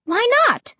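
very high, alarmed demand; afraid, demanding. A narrator's reading. Child challenging Ray, suddenly afraid. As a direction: shoot the question up high in alarm. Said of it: "I demanded, suddenly afraid".

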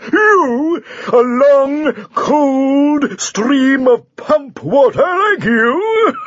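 bullying champion. Acted. The champion sneers at the thin knight, slow loud mockery. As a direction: drag out the insult with contempt.

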